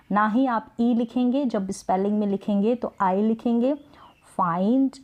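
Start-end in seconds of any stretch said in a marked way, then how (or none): 4.4-4.9 "फाइंड" with an "eye" vowel; long i. Shown as a demonstration. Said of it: "उच्चारण होगा वो long होगा".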